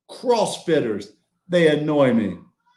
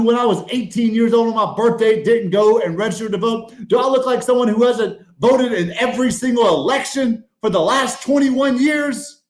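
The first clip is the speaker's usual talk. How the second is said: ordinary talk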